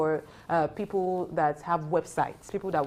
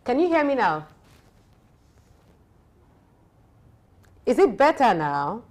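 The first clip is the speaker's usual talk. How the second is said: raised voice over a bad line; louder; 6 semitones higher, 6 dB louder than usual. Real, not acted. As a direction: speak up so they can hear. Checking a bad phone line.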